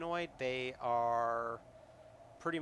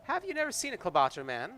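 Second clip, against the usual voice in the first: high, quick and cheerful; jovial creature; 8 semitones higher, 6 dB louder, faster than usual. Acted. Kobold cheerfully questioning the player, jovial. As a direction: bounce it out cheerfully. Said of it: "asks jovially".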